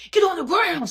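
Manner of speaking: sudden high barked command; imagined cop shout. Retold or imitated. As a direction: yell it like a guard storming in. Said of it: "bust in the door and be like".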